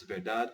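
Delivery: "verdade" ending on a hard d; "verdade" cut short after the second d; final E dropped. Shown as a demonstration. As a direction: say "verdade" with the last E left off, like "ver-DAHD". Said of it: "they just take out the E".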